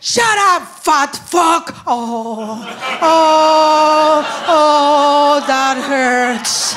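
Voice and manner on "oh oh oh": hammed-up wounded groans; mock groans of pain. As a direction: act deeply hurt. Hurt by insult.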